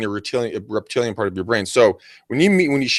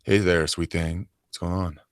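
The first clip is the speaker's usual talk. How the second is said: creepy voice; 5 semitones lower, 5 dB quieter than usual. Shown as a demonstration. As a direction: creep at the bar crooning. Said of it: "with a creepy voice like".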